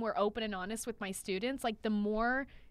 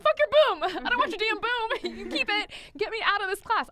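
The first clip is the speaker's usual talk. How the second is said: higher, louder outburst, half laughing; hammed-up angry refusal to a friend; mock furious rant; 11 semitones higher, 6 dB louder than usual. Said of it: "I'm like".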